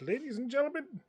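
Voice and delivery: hesitant showman pitched a little high, with emphatic bursts; showman's address. Acted. Game master voices the bird performer to an audience, showman's address. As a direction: address a crowd grandly, fumbling for words. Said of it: "he goes out there and he says".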